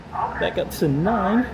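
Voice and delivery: put-on slow, stretched Georgia accent on nine; Southern drawl. Imitating how Southerners say it, Southern accent. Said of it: "As they would say here in Georgia".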